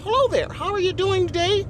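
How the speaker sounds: mock proper phone-manner voice. Shown as a demonstration. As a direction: put on a high, non-threatening greeting voice. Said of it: "sometimes you gotta code switch".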